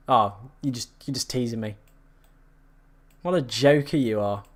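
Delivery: ordinary delivery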